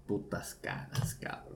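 whispered, breathy muttered curse. Retold or imitated. Angry boyfriend curses his girlfriend under his breath, whispering. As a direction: hiss it under your breath.

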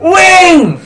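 high, loud yelling a name; retold scream. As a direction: scream the name across the field. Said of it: "He used to scream".

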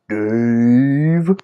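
long drawn-out eerie call, slowly rising; ghostly recorded voice. Retold or imitated. Storyteller imitating the voice caught on camcorder, eerie drawn-out call. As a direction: stretch the name out like a ghost. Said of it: "we actually heard a voice go".

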